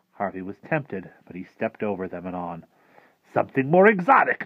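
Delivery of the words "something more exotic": high, loud showman's pitch; enticing offer. Acted. Rictus tempting Harvey with parrots, enticing. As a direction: dangle it with a big, lifted voice.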